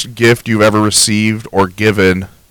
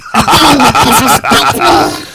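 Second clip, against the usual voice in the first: high villain laugh; 3 dB louder than usual. Acted. Mock villain gloating laugh.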